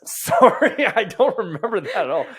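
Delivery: high and loud, laughing through the words; laughing apology. Real, not acted. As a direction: apologize while cracking up. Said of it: "I know you don't".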